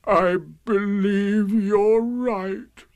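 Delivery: very slow, high mournful donkey voice; Eeyore's sad sigh. A narrator's reading. Dejected donkey admitting his loss, with sad sighing. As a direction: drag it out, then heave long breaths. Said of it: "said with a long, sad sigh".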